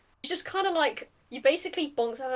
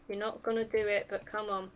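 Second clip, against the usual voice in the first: ordinary talk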